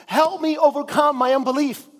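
a hint of a father crying out for help; father's exclamation. A narrator's reading. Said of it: "the boy's father exclaimed".

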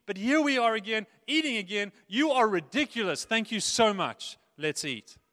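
loud, fast, gushing thanks; overflowing with delighted thanks; exuberant prayer. Acted. Dad praying at the table, gushing and loud.

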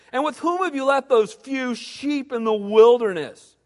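put-on a touch of a scornful older brother; scolding big brother. Scornful big brother scolding. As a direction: Eliab sneering down at his little brother.

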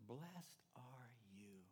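slow, hushed whisper; whispered blessing. Acted. Jesus blessing the broken, slow hushed whisper. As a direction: breathe it out slowly as a gentle secret.

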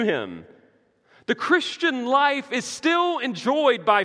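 loud, high, impassioned preaching; full-force preaching. Real, not acted. Pastor assuring friends of the resurrection, preaching at full force. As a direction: drive each phrase home forcefully.

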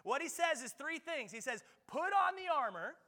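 high, loud, slowing pulpit preaching; preaching at full force. Acted. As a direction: drive the charge home to the congregation. Preaching the armor of God.

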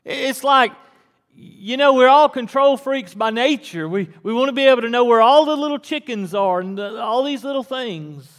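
high, loud, fast sermon riff; preacher joking about control freaks, loud and quick; animated preaching. Acted. Preacher to congregation, loud and high.

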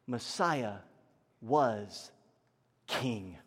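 low, word-by-word statement with long pauses; solemn emphasis. Real, not acted. Preacher defines Messiah for church, slow and solemn. Key point said slowly, then restated.

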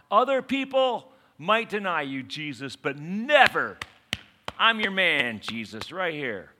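loud, high boasts dropping on the name; emphatic pledge. Acted. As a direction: punch out a hammed-up declaration of loyalty. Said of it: "He's emphatic about his commitment".

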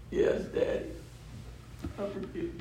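put-on hushed small-child voice; soft, tender imitation of a child; forgiving little boy. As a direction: answer softly as a tearful little son. Voices his five-year-old forgiving him.

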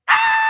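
very high, loud mock squeal; excited squeal. Preacher imitating Mary and Elizabeth meeting, excited squeal. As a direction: squeal like two cousins reunited. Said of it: "they were both like".

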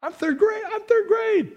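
high-pitched indignant squeal; mock protest. Acts out his protest to teacher.

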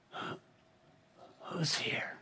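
weak, breathless whisper of an exhausted man; barely any strength left to whisper; faint whisper. Retold or imitated. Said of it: "And in a faint whisper".

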